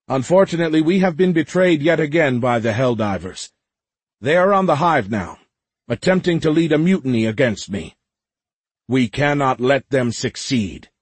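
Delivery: high, declamatory captain's announcement; captain's PA address. A narrator's reading. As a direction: address all passengers, denouncing the traitors. Captain denouncing traitors over the PA.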